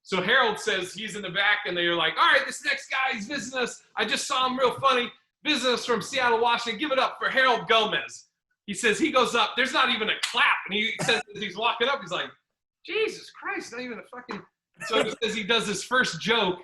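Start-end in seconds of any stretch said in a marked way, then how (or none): 2.2-8.2 higher-pitched imitation of a club host; emcee introduction. 12.8-14.4 high, hushed mock complaint; exasperated aside. Comic grumbles walking up unapplauded.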